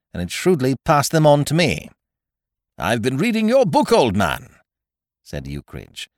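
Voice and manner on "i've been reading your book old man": airy remark, high and loud at the start; overdone carelessness. A narrator's reading. Ukridge breaks a pregnant silence, overdone carelessness. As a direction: toss it off too breezily. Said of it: "with an overdone carelessness".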